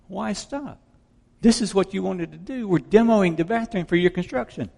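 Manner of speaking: high-pitched voice for the daughter; lifted, innocent mock protest. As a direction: imitate a puzzled daughter defending herself. Daughter answering her screaming mother.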